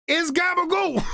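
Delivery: high mock Jersey Italian "gabagool", falling at the end; "yo, it's gabagool" with a mock Jersey swagger; Jersey Italian accent. Streamer answering the chat in a mock Jersey Italian accent.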